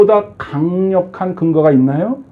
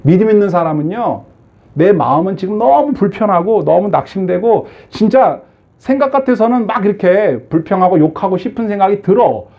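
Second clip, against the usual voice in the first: usual speaking voice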